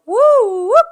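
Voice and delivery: very high, very loud piercing woo whoop; woo whoop call. Shown as a demonstration. Teachers calling the whole school to attention, piercing high whoop. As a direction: pierce through the room with it. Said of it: "they used to go".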